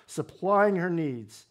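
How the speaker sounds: usual speaking voice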